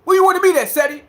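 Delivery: high-pitched challenge to fight; Umar. Retold or imitated. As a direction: mimic a Philly man calling someone out. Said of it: "Umar talk like that".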